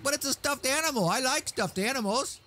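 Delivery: high, silly dog voice; dog pleading about his stuffed animal, high; voicing the dog. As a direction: give the dog a high whiny voice. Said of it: "He's like".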